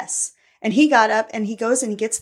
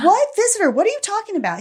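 high-pitched incredulous retort; retold startled question; 12 semitones higher, faster than usual. Questioning him about the visitor, startled retold voice. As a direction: jump up in pitch, baffled.

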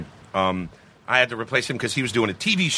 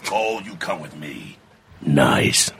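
gruff squad leader, low and rough; Marcus Phoenix; 9 semitones lower, slower than usual. Acted. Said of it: "sounds like he's gargling concrete".

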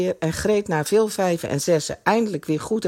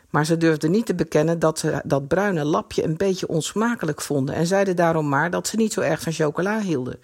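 ordinary delivery

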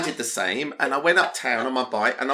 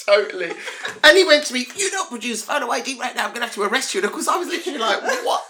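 laughing retelling; 9 semitones higher, 4 dB louder, faster than usual. Real, not acted. Retelling cop threat, laughing.

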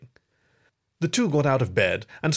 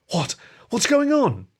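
high, loud, panicked question; alarmed cry; 11 semitones higher, 4 dB louder than usual. A narrator's reading. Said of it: "Harry cried".

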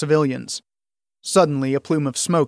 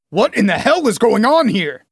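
angry outburst, higher pitched; furious demand; 7 semitones higher, 4 dB louder than usual. A narrator's reading. Soldier confronts the officers at the massacre, furious. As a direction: demand it in outrage. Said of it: "Radrick demanded in a fury".

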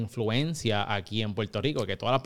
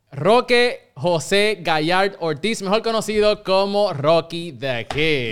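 slow, louder host announcement of the guest; announcer intro; 5 semitones higher, 6 dB louder, slower than usual. Acted. Host presenting his guest, slow and louder, announcer style. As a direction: boom out the guest's name like a show host.

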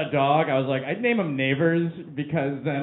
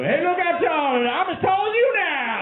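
much higher, goofy taunting; mock triumphant call from on top of the table; boastful guy on table. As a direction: crow down from the coffee table. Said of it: "What if I get up on the coffee table?".

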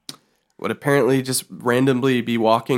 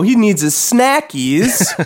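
put-on baby talk; 8 semitones higher, slower than usual.

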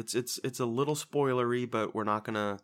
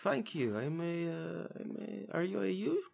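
high-pitched put-on accent; hesitant, halting questions in the character accent; Herschel accent impression; 10 semitones higher than usual. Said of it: "That was a good accent".

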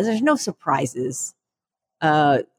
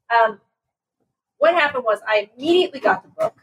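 usual speaking voice

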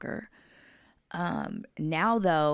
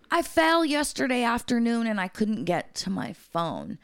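lightly voiced client, pitched up and emphatic; imitated client; 7 semitones higher, 4 dB louder than usual. Injured client explaining to caregiver, lightly imitated raised voice.

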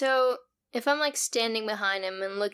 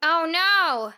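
higher, louder hammed-up alarm; dismayed cry; 7 semitones higher, 4 dB louder than usual. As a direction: play up the horror. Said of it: "And she's gonna go".

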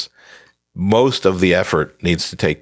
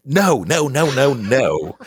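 high, drawn-out string of objections; emphatic refusal; 8 semitones higher, slower than usual. Acted. Author scolding writers eager to start next book, emphatic refusal. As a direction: cut them off with high, stretched protests.

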